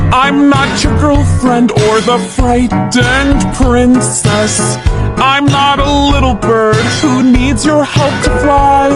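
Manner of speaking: normal talking voice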